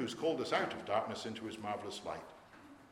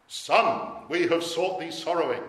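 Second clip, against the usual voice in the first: loud, raised quote of a searching parent; parental reproach; 5 semitones higher, 8 dB louder, slower than usual. Acted. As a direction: call it out higher and much louder.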